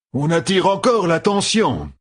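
a man warning his companions in battle; remarking aloud. A narrator's reading. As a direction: point out the danger drily. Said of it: "lança Emarine".

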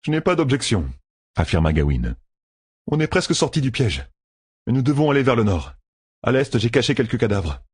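a hint of a soldier reporting; Gawain's voice. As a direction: report briskly.

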